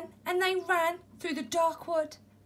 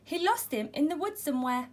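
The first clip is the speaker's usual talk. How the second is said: ordinary delivery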